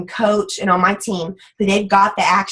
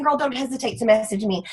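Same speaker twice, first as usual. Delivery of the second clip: ordinary talk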